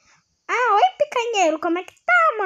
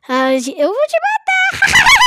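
laughing threat; 11 semitones higher, 5 dB louder, slower than usual. Acted.

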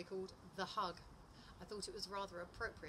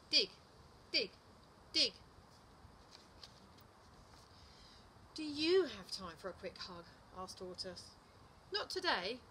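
animated picture-book narration, pitch swinging up; lively storytime voice, key words punched; story reading to children; 4 semitones higher, 3 dB louder, slower than usual. A narrator's reading. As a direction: read it to little children with big expressive emphasis. Reads second story aloud.

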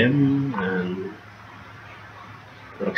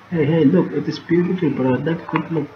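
pitched up, eager and delighted; excited; 7 semitones higher than usual. Real, not acted. Gamer excitedly showing a friend the view. Admiring something in the game.

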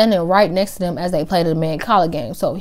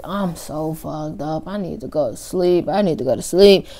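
put-on drunk mumbling; 2 semitones lower than usual. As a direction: mumble it out sleepy and wasted.

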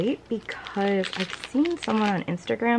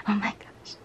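whispered gasp; 4 dB quieter than usual. Real, not acted. Gushing over photo cards.

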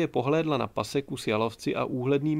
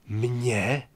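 breathed out. A narrator's reading. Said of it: "vydechl Čmelda".

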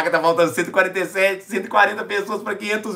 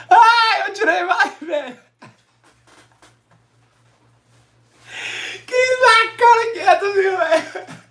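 high, sobbing disbelief; crying with joy; 13 semitones higher than usual. Real, not acted. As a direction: weep through the words. Reaching half a million live. (chorando de emoção, voz aguda)

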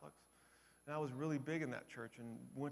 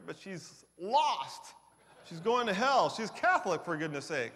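mock dismay; 5 semitones higher, 9 dB louder, slower than usual. Mock dismay over her faith.

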